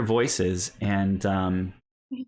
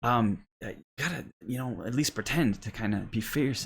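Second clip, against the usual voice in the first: Cole; 5 semitones higher than usual. Acted. Boy prompts his playmate to act fierce, hushed coaxing. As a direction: coax a playmate, out of character. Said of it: "you hear him kind of say".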